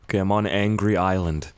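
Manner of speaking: usual speaking voice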